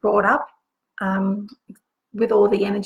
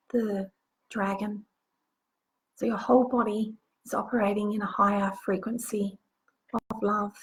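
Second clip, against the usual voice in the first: guided meditation. Acted. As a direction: guide them gently into the visualization. Leads a guided visualization.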